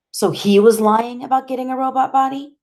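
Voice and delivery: high question climbing to the end; upset disbelief. A narrator's reading. Boy asking doctor about his friend's lie, upset disbelief. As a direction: ask it high, in pained disbelief.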